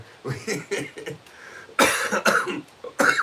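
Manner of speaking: long, breathy burst of laughter; real wheezing laugh; laughing hard. Real, not acted. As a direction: crack up helplessly. Cracks up after mocking co-host.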